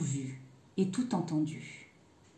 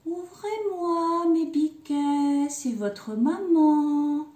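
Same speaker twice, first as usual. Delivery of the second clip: high, slow, drawn-out singsong; sweetened wolf voice; 6 semitones higher, 4 dB louder, much slower than usual. A narrator's reading. Wolf posing as mother after honey, high sweet singsong. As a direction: coax sweetly in a high, stretched-out voice. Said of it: "en prenant une voix toute douce".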